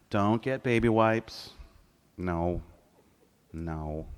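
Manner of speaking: ordinary talk, nothing marked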